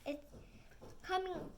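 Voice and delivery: plain reading voice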